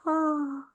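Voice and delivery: gentle melting "ahh"; imitated muscle release. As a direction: imitate a muscle letting go with a soft sighed "ah". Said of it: "their muscle goes".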